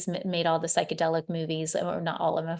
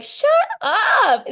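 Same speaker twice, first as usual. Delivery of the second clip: shrieked high and loud in disbelief; astonished shut up; 9 dB louder than usual. Retold or imitated. Hearing the Egyptian priestess vision confirmed, a high loud astonished cry. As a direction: blurt it out high, floored by the coincidence. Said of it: "And I'm like".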